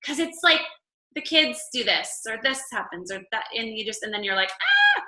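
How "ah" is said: much higher, louder frantic cry; overwhelmed mock scream. Mom swamped by kids and chaos, frantic cry. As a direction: let out a panicked cry.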